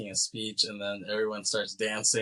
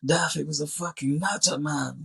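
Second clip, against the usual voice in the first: raised, louder put-on character voice; imitated movie character; 5 semitones higher, 4 dB louder than usual. Character confiding about someone to a friend, imitated voice. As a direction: play a character sounding off, higher-pitched.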